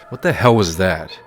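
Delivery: loud, outraged repeat. Real, not acted. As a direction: punch it out in disbelief. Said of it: "My reaction".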